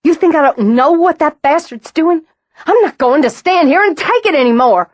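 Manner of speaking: high, loud outraged yell; furious shout. A narrator's reading. Roz shouting at Haley, high and loud with fury. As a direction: blast it back at her. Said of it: "The shout, the fury in it".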